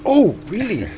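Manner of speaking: high-pitched "oh, really?"; mild surprise. Acted. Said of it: "it's like".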